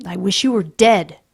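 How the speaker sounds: loud hateful line; bitter child. Acted. Peter to his father, loud and bitter. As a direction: wound him with it.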